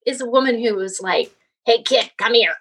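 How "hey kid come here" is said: gruff wrestler. Retold or imitated. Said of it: "like a wrestler".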